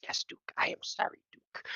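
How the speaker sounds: meek, breathless whisper; very soft, sheepish whisper; whispered apology. Acted. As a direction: whisper it like a scolded kid. Apologizing meekly to the dog.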